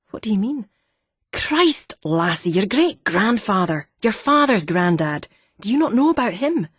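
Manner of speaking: can't believe she doesn't know, in Fife; incredulous Fife accent. A narrator's reading. Miner scolding the daughter's ignorance, Fife accent. Laidlaw exasperated.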